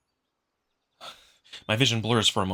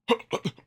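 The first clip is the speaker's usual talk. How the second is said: startled gasp; 11 semitones higher than usual. Acted. Amicus startled, calling after.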